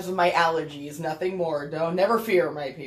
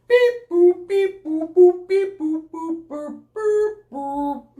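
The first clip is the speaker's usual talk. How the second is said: high beeping robot voice; loud robotic beeps and boops; 8 semitones higher, 6 dB louder, slower than usual. Pretend. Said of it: "do you wanna do a robot voice with me".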